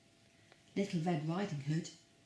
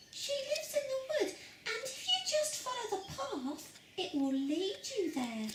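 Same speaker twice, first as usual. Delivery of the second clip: put-on much higher, sweet little girl; 5 dB quieter than usual. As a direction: squeak it like a tiny girl. Girl voiced in the story.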